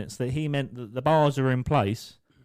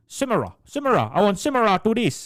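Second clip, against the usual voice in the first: higher, louder voice with broken English; imitated limited-English speaker; 7 semitones higher, 4 dB louder than usual. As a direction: insist on it as a mock foreign customer. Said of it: "He knew about four words of English".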